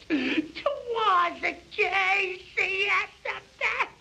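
very high, slow, drawn-out cry; ringing proclamation. A narrator's reading. Narrator announcing Casey to the crowd, high ringing proclamation. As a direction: proclaim the hero with a soaring voice.

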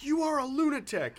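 high, loud mock yell at the other man; retold movie yell. Friend retelling a film fight, mock shouting the insult. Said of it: "He's like".

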